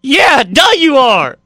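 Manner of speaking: loud, high-pitched incredulous shouting; drawn-out, hammed-up exasperated yell; mock exasperation. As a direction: yell the obvious in comic disbelief. Mock outburst at fighter's obvious claim.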